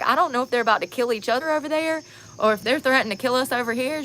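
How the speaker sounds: high, loud, excited retelling of a friend; loud, high mock told-you-so. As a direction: burst out like she saw it coming.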